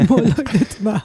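laughs through the words; giggling while telling it; laughing. Real, not acted. Laughs recalling the screaming. (צוחקת תוך כדי דיבור)

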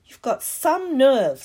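loud, high, drawn-out outrage; slow, loud and affronted. A narrator's reading. Ron outraged at Hermione's plan, loudly indignant. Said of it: "said Ron loudly".

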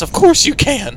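high, eager agreement; lifted, enthusiastic retold reply; emphatic assent. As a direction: insist brightly that it's fine. Retold answer repeated with emphasis.

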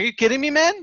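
mock disbelief. Shocked at the forty dollar bill.

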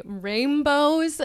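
ordinary talk, nothing marked

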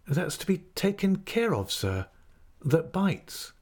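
put-on high-pitched, deferential young pupil voice; polite schoolboy. A narrator's reading. Boy questions the schoolmaster.

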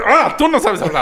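high, laughing taunt; mock taunting. Relative mocking his English, high laughing jeer.

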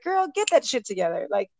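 mock sassy coworker, high and pushy; sassy friend. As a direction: push her along like a no-nonsense bestie, rising on go. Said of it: "And she's like".

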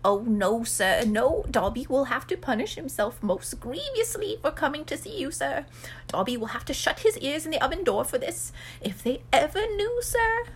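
high, servile elf, climbing at the end; fearful house elf. Acted. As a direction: dread punishment in a little creature voice. Plays Dobby, shuddering at punishment.

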